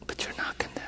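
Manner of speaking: breathy whisper; whispered answer. Shown as a demonstration. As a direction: whisper it gently.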